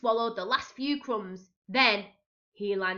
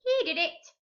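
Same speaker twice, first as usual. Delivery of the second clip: high squawk; squawking parrot; 9 semitones higher, 4 dB louder than usual. A narrator's reading. Silly purple parrot blames Pete, high squawk. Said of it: "squawked the silly bird".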